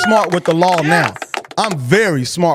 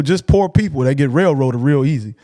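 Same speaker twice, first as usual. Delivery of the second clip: ordinary delivery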